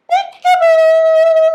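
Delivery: high, loud peekaboo shout; spring the surprise on them; bursting peekaboo; playful peekaboo. Acted. Surprise reveal for children.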